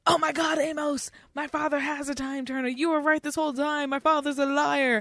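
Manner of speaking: lightly acted excited schoolboy; excited kid. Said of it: "they're like".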